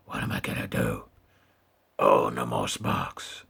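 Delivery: deep Batman growl; gravelly Batman voice. Pretend. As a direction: talk like Batman. Batman voice held.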